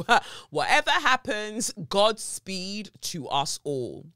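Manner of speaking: a jokey send-off before diving in; godspeed said slow and grand; mock dramatic blessing. Her own mock solo podcast start.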